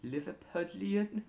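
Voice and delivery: high, questioning mock Scouse; amateur Scouse, a little Welsh; Liverpudlian accent attempt. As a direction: pitch up into a tentative Liverpool accent. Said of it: "if that was Welsh or Liverpudlian".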